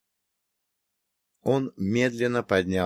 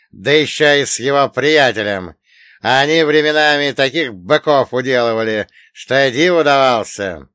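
громкий злой голос; кричит со злостью; громко и зло; 4 semitones higher, 9 dB louder than usual. Acted. Злодей в ярости из-за сына. (громко, с яростью)

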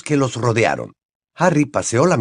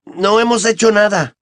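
high-pitched, wronged protest; indignant; 10 semitones higher, 3 dB louder than usual. A narrator's reading. Ron rubbing his elbow, protesting to his father, indignant. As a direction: deny it with indignation. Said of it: "con expresión indignada".

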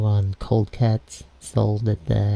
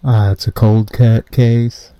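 loud muffled voice; through a wall; 9 dB louder than usual. Acted. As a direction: speak like behind a wall. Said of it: "You, you sound muffled".

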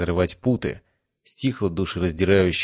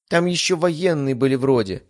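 higher, lightly voiced child; boy's mumble; 7 semitones higher than usual. A narrator's reading. Boy calming down by grandmother, mildly mumbled in high voice. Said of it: "пробормотал мальчишка".

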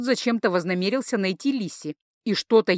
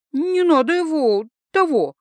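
higher, louder, slow defence of a friend; protective; 7 semitones higher, 5 dB louder than usual. Acted. Etain defends her friend, heated and higher. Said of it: "встала на защиту друга Этейн".

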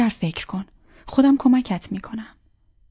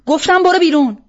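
higher, much louder command through clenched teeth; furious repeated order; 8 semitones higher, 9 dB louder than usual. Acted. Roja orders Arsalan out again, enraged shout. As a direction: bark it at him one last time.

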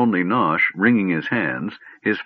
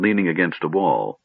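plain reading voice

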